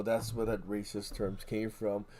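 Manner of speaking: ordinary delivery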